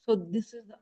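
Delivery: very soft, almost swallowed; barely audible. Real, not acted. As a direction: speak so softly it barely carries. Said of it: "your voice is very low for me".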